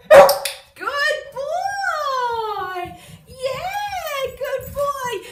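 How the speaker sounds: high, loud, gushing praise for the dog; squealing with delight at the dog; excited praise. Real, not acted. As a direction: cheer him on with a big bright voice. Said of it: "and tell him".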